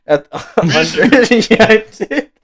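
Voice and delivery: breaking into loud laughter mid-sentence; talking while cracking up; laughing. Real, not acted. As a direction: laugh through the words.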